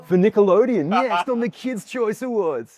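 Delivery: put-on loud, high, excited kids' channel announcer; Nickelodeon promo voice. Shown as a demonstration. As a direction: punch it up high and bright for kids. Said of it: "more in, like, the yellow zone".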